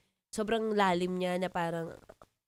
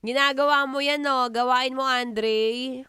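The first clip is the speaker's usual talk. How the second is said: raised, teasing call to Andre; loud teasing; 4 semitones higher, 8 dB louder, slower than usual. Real, not acted. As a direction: call him out loud and playful. Teasing a viewer loudly. (malakas na pang-aasar)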